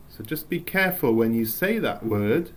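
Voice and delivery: normal talking voice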